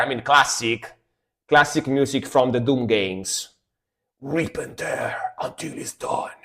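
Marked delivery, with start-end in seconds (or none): 4.2-6.3 whisper. Retold or imitated. Quoting Doom line.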